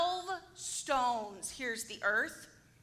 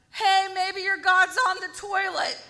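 loud, raised mock heckle; taunting; 4 semitones higher, 9 dB louder than usual. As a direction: call it out to needle the rivals. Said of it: "He's just taunting them".

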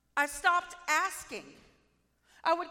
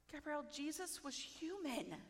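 much softer, patient; gentle Holy Spirit; 13 dB quieter than usual. Retold or imitated. Holy Spirit patiently teaches her, soft and gentle. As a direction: explain softly and kindly. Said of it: "so sweet, so gentle".

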